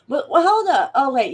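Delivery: high, loud hold up; sudden halt. Real, not acted. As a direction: stop yourself short in surprise. Suddenly stops, catches a mistake.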